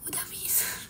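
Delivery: very soft, whispered reply with no voice; voiceless. Real, not acted. Streamer telling viewers they already had a drink, voiceless. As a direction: let only air come out.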